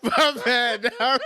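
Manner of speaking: high, laughing apology; laughing through the apology. Real, not acted.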